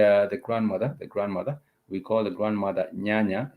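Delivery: ordinary delivery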